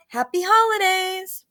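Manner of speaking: stretched, lifted parting call. A narrator's reading. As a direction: call it in a slow sing-out. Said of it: "I called".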